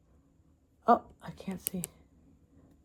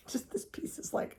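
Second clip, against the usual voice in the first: whispering; 16 dB quieter than usual. Real, not acted. Awed aside.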